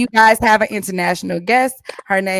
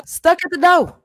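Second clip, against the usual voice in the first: playful punchline; 6 semitones higher than usual. Acted. Punchline riff on the theme.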